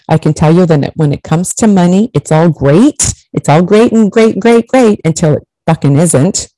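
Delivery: normal talking voice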